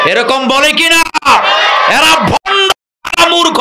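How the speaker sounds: fiery preacher's condemnation; angry shout. Real, not acted. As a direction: rail at them at full force. Preacher denouncing fake pirs.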